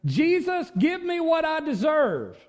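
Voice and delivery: high, insistent mock demand. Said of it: "you don't ask Jesus".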